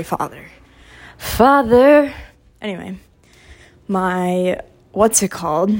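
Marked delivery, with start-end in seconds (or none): 0.0-0.4 mock-solemn low. Apologizing to her dad, a hushed low Father. 1.2-2.1 higher and louder, a hammed-up cry; mock plea. Pleading to her listening dad, a hammed-up loud Father.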